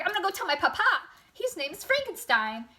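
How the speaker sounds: put-on high, loud tattling child; freaked-out little boy. Little boy threatening the monster with his papa, mock freak-out. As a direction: play an indignant little boy threatening to tell. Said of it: "freaks out".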